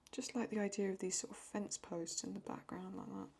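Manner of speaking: whispered aside while drawing; hushed working whisper. Real, not acted. Drawing quietly, half to herself.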